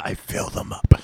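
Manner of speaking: hushed, creepy quoted lyric; creepy whisper. Retold or imitated. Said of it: "or some other fucking creepy".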